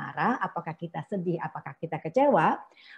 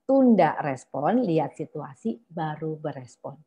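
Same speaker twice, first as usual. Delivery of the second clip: ordinary talk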